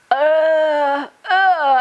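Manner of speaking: high, loud strained mock groans, imitating mum; awkward groan. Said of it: "and groans, you know, moans".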